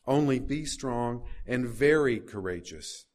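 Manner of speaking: slow, stress on "very"; "very" drawn out and stressed, the line slowed; emphatic reading. Shown as a demonstration. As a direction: lean on "very courageous" with weight. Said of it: "mentioned a second time with emphasis".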